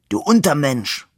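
wounded men trading insults, calling out. A narrator's reading. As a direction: fling the insult at him. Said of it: "rief der eine".

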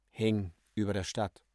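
usual speaking voice

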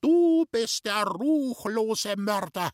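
high, loud, drawn-out condemnation; screamed accusation. A narrator's reading. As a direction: shriek it at the culprit in fury. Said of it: "hat noch lauter geschrien". (laut und wütend angeklagt, hoch geschrien)

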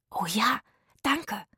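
grateful whisper. A narrator's reading. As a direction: whisper your thanks. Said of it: "flüsterten Zora".

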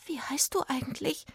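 high, soft fairy voice; small, shy-sounding fairy; little fairy. A narrator's reading. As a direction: ask softly in a tiny, high voice. Little fairy asks his name.

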